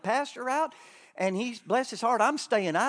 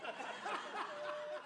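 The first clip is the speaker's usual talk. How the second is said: laugh; 13 semitones higher, 16 dB quieter than usual. Real, not acted. Laughs at the crowd.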